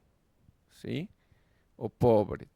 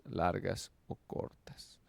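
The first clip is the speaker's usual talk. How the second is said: whispered aside; 3 semitones lower, 6 dB quieter, slower than usual. Real, not acted. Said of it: "teacher reading definition".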